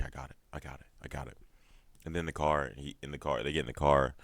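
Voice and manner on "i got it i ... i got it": cowed mumble. Retold or imitated. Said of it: "And his brother's like".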